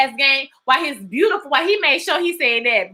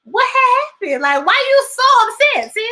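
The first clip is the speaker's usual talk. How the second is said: high, disbelieving what-happened; pitched way up in mock alarm at her sister; incredulous girl; 12 semitones higher than usual. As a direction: friend asking, shocked, why she's upset. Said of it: "That's why I was like".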